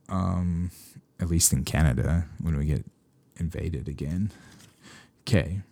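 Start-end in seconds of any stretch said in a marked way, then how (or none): none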